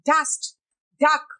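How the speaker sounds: dust, duck with a light English d; soft English D. Shown as a demonstration. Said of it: "ड sound soft होता है". (dust, duck में नरम ड)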